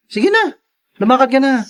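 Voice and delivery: high, eager push to leave; impatient shooing. Acted. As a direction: get her out the door fast. Pushing wife out the door. (nagmamadaling pagtataboy)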